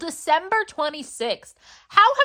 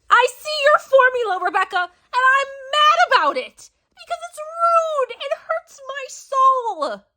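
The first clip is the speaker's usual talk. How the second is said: mock anguished whine; 13 semitones higher, 4 dB louder than usual. Reader hamming up heartbreak at the author, very high.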